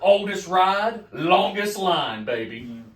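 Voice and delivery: slow, loud swaggering boast; boastful catchphrase. Pretend. A forty-seven-year-old brags about himself like Space Mountain, a slow loud swagger. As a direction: strut each word out like a promo.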